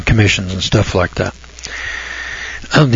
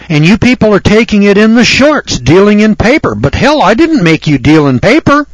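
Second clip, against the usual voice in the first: loud, raised, indignant scolding; heated rant; 6 semitones higher, 4 dB louder than usual. Real, not acted. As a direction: lay into them for dealing in paper. Lecturer rants at paper-money users.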